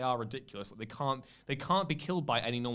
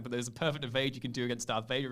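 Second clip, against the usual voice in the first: usual speaking voice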